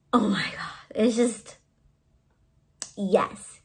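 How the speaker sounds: breathless whisper of wonder; awed whisper. Real, not acted. Vlogger gazing at her grail pop, awed whisper. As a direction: hush it like it's sacred.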